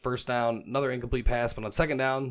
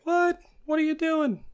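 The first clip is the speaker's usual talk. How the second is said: very high, squeaky disbelieving voice; shocked squeak. Retold or imitated. Coach in the back squeaking at the quarterback. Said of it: "He's like".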